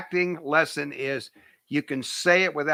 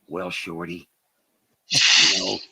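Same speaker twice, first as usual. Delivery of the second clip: Clint Eastwood; 9 semitones lower, slower than usual. Retold or imitated. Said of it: "you can hardly ever hear him when he talks".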